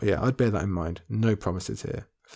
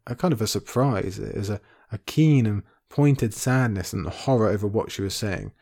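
ordinary delivery

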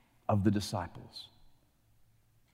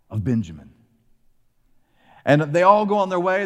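normal talking voice

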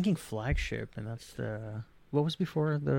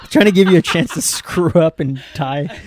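laughing; 7 semitones higher, 9 dB louder than usual. Real, not acted. Teasing after winning.

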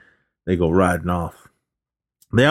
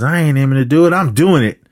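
higher, louder cocky comeback; retold defiance; 7 semitones higher, 5 dB louder than usual. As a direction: imitate a defiant outlaw. Robber's defiant answer, acted out.

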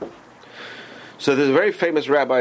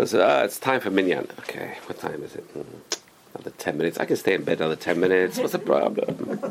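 sleepy stalling; 6 semitones lower, 4 dB quieter than usual. Acted. Acted lazy riser stalling in bed.

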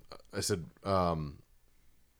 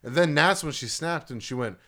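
usual speaking voice

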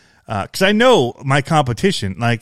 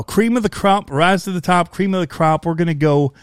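higher-pitched Southern showman's drawl; 5 semitones higher than usual. Retold or imitated. Retelling his hammy interview line, Southern drawl. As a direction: let a Southern drawl creep into the boast. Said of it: "started coming out with that drawl".

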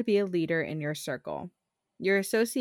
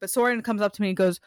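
ordinary talk, nothing marked

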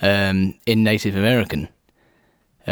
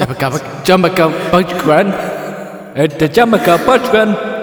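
show announcer voice; 5 semitones higher than usual. Pretend. Hamming up the show name.

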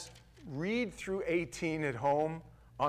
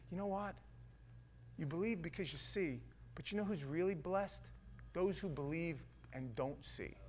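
soft gentle rebuke; 10 dB quieter than usual. Retold or imitated. Jesus gently answers doubting Thomas.

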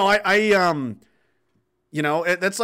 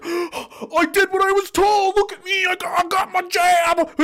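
very high, squealing mock voice; gushing conformist; 4 dB louder, slower than usual. Showing off the jab in a selfie, squealing mockery. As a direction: squeal it like a preening selfie taker. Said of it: "taking selfies of their, like".

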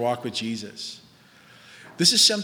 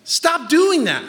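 high, loud, drawn-out rebuke; raised, emphatic telling-off; calling someone out; 10 semitones higher, 5 dB louder, much slower than usual. Acted. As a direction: cut in sharply, then stretch the command. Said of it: "And then someone goes".

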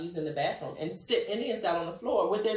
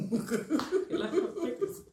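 slow words through a laugh; real laughter on the punchline; 7 semitones higher, much slower than usual. Real, not acted. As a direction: stretch it out while laughing. Laughing at own joke.

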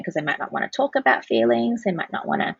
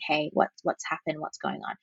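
softly, fading to a hush; soft hushed question to a seven-year-old; soft calm tone; 3 semitones lower, 7 dB quieter than usual. Shown as a demonstration. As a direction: kneel down and ask gently, almost whispering. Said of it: "gotten down on my knees and going".

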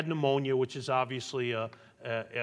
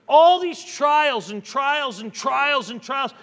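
preaching crescendo; 11 semitones higher, 11 dB louder, slower than usual. Acted. Preaching climax on trials.

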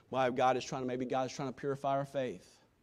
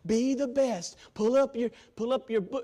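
ordinary delivery

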